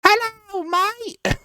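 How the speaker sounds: put-on very high-pitched little elf kid; Duskwirl. Shown as a demonstration. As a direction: squeak it like a tiny British boy. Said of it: "that's Duskwirl right there".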